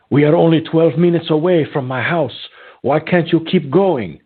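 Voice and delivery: high mock Brazilian accent of a complaining passenger; imitated Brazilian accent. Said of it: "with her accent".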